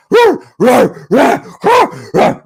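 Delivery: high, loud mock dog barks; imitated barking. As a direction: bark it out like an excited dog. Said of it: "The dog will be in the backyard barking".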